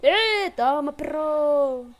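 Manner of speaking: loud, drawn-out victory taunt; whoop, then stretch the taunt out; triumphant yell. Real, not acted. Streamer beating her opponent in the game, triumphant yell. Celebrating a win.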